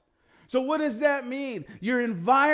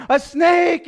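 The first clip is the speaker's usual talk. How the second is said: sudden scream, much louder and higher; frightened shout of warning; screaming mom; 5 semitones higher, 11 dB louder than usual. Retold or imitated. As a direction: yell it like a panicked mom spotting a snake. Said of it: "she starts screaming".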